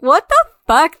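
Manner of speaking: high, loud disbelief; acted-out shocked reaction; shocked outburst. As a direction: blurt it out, stunned. Retells own shocked film reaction.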